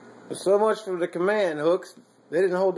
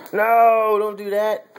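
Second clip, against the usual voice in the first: sharp no; 5 semitones higher, 7 dB louder, slower than usual. Real, not acted. Dad stopping his toddler dumping food, loud sharp no. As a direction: bark a firm no to stop him.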